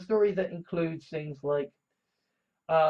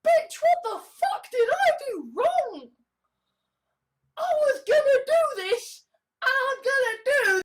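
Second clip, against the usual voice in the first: very high, louder, near-tearful yell, the end fast; upset outburst; 3 dB louder than usual. Retold or imitated. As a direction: rant in tearful outrage, pitched up high. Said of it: "and I am just like".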